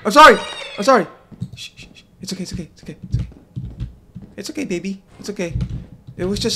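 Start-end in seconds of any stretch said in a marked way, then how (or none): 0.0-1.1 high loud frantic sorry; loud apology. Real, not acted. Gamer yelling sorry at the creature he hurt. 1.6-5.5 soft shushing and calming; calm the scared pet gently; soothing shushing. Real, not acted. Gamer softly soothing the creature he startled.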